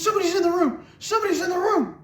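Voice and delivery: put-on high, loud, panicky child's cry; high panicked kid telling mom about an intruder; frightened child. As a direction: blurt it out high and scared. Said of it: "She says".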